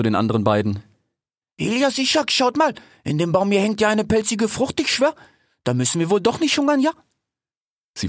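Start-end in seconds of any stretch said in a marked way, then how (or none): 1.6-6.9 put-on high-pitched street-slang cheetah girl; excited big-cat voice, pitched way up; female cheetah. A narrator's reading. Cheetah calls her two brothers to look up the tree, high slangy voice.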